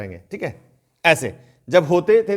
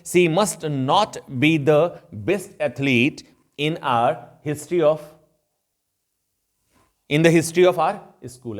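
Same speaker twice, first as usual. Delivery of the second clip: very slow, careful English example sentence; slow example read; much slower than usual. Shown as a demonstration. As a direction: read each word out slowly for the class. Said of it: "teacher reads example slowly". (धीरे-धीरे उदाहरण वाक्य पढ़ना)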